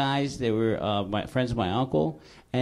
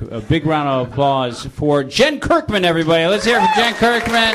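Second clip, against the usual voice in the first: announcer voice; 2 semitones higher, 9 dB louder than usual. Acted. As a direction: hype up the guest. Host introduces guest.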